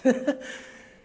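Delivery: sharp high nervous laugh. Real, not acted. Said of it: "I'm nervous".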